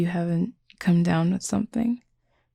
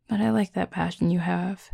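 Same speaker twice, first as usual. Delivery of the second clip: ordinary talk, nothing marked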